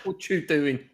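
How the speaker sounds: high, loud mock Cockney heckle; laughing Cockney imitation; Cockney accent. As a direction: play the rowdy Cockney bloke. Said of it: "Cockney accent".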